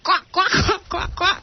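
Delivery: high duck quacks held through the lines; high quacking. Acted. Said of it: "go into your quacking sound".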